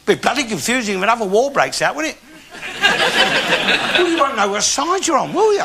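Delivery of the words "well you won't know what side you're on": ordinary talk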